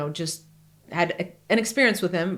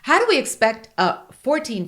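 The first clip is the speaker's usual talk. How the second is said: normal talking voice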